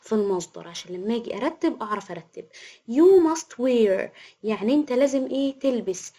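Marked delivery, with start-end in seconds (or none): 2.9-4.1 slow dictation. Shown as a demonstration. Teacher dictating example sentence.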